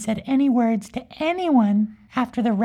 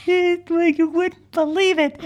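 higher, still breaking up; through laughter; 5 semitones higher than usual. Real, not acted. Storyteller insisting after cracking up, through laughter. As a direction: talk while recovering from a laugh.

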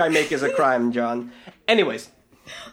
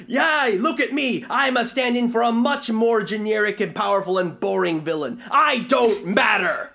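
high, loud villain shout; mock shout; 4 semitones higher, 3 dB louder than usual. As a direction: belt it like a hammy villain. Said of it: "He shouts".

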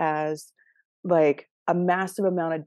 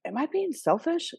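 high, soft worried question; a hint of guilty nervousness; mock timid worry; 7 semitones higher, 4 dB quieter than usual. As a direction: ask it small and anxious, way up high. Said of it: "they're like".

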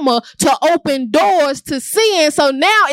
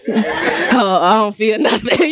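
lower, laughing through the denial; 4 semitones lower, slower than usual. Retold or imitated. Teen to the pastor, denying it while laughing. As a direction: fake it can't be felt, giggling.

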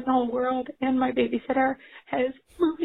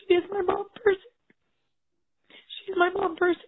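tearful, voice breaking; crying; 6 semitones higher than usual. Real, not acted. Mom on a voicemail naming her best friend, crying. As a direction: choke back real tears. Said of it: "I am having a breakdown".

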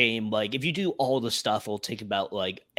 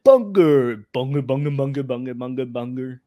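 slack-jawed creature repeating its name; dim, slack-jawed chant of a name; dopey voice; 7 dB louder than usual. Retold or imitated. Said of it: "a dopey voice".

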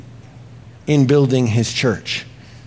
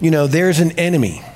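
ordinary delivery

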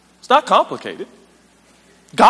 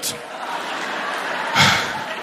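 big sigh of release; empty the lungs in a long airy sigh; relieved, drawn-out breath out; long exhale; 7 dB quieter than usual. Acted. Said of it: "I gotta exhale".

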